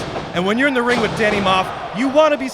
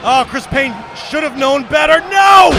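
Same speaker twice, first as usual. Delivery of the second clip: rising to a high, loud scream; anguished shout; 7 semitones higher, 7 dB louder than usual. Real, not acted. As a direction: shout in horror as he falls. Manager sees his wrestler hit.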